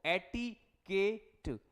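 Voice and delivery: Etiquette stretched out slowly, syllable by syllable; slow teacher's spelling-out; slow breakdown. Shown as a demonstration. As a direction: draw out etiquette for dictation. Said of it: "crumble कर लिया करो".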